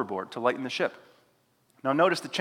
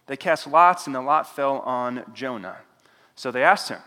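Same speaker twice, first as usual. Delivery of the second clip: ordinary delivery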